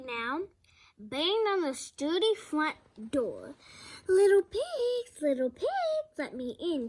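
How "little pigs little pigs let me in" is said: growling wolf. A narrator's reading. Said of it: "he growled".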